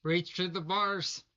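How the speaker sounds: slow, loud booming order; dramatic command. Acted. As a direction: boom it out slowly. Player acting dramatic command.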